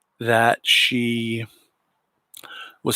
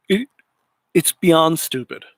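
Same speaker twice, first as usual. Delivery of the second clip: "beyond" pushed up higher, scornful and firm; emphatic contempt; 5 semitones higher than usual. Real, not acted. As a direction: spit out "it's beyond stupid" with disgust. Ranting about the reporter.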